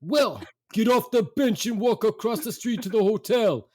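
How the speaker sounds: high-pitched, put-on bossy dad; impatient dad. Dad ordering mother off the bench, impatient. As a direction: imitate a father ordering someone along, exasperated. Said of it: "And my dad says".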